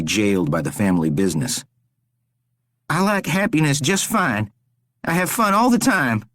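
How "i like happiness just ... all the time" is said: high, desperate begging; pleading captive Rory. A narrator's reading. Rory begs the stranger to spare him, desperate. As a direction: beg for your life, pitched up.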